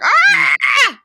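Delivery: high, loud imitated shriek; mock frenzied scream. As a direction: scream like a girlfriend losing it. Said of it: "She loses her mind".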